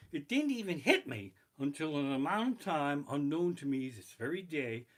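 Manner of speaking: ordinary talk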